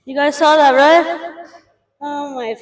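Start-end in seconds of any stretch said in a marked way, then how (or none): 0.0-1.5 loud, excited; excited shout. Real, not acted. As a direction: shout it out thrilled. Excited after lift.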